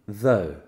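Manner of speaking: though said as English "thoh". Shown as a demonstration. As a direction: model though for learners. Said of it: "on écoute [name]".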